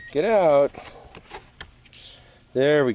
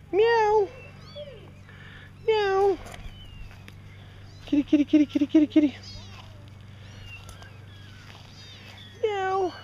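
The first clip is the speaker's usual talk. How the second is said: high kitty call; 4 dB quieter than usual. Real, not acted. Calling kittens, meowing.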